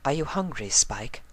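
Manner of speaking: Rarity gently asks Spike; tender. A narrator's reading. Rarity caring.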